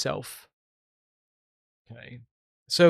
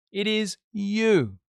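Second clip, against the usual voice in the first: slow IS and long, higher YOU; emphatic insistence; 4 semitones higher, much slower than usual. Real, not acted. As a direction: drive it home, stretching out "you". Driving home the accusation.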